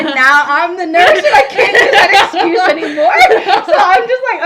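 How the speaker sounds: loud, high, laughing through it. Real, not acted. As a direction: say it cracking up. Laughing about being the nurse.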